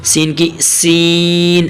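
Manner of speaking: سین with a long whistling s; drawn-out whistled سین; whistling seen. Shown as a demonstration. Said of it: "سیٹی کی آواز بنا کر".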